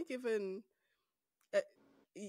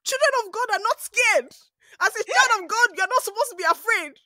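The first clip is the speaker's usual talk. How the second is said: mock preachy mom; 13 semitones higher, 12 dB louder than usual. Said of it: "laughing, mom went off".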